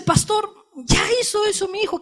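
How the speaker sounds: mother telling the pastor, high and soft. Retold or imitated. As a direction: plead with the pastor. Mother tells pastor resigned.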